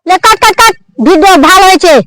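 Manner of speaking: loud wrap-up call; forceful, projected approval after the take; director calling cut. Acted. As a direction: call a halt loudly to the whole crew.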